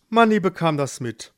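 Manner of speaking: ordinary delivery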